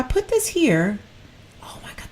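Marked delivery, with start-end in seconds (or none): 1.6-2.1 hushed unvoiced aside; whisper. Real, not acted. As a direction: whisper it as if to yourself. Whispering while admiring items.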